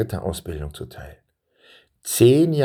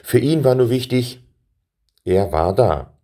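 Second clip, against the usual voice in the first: ordinary delivery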